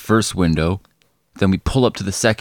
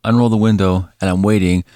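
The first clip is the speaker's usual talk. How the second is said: usual speaking voice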